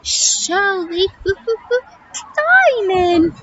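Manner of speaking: very high monkey voice with hoots; excitable monkey. Acted. Monkey answering how she'll cross, high hooting voice. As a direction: squeal it high and loud, hooting.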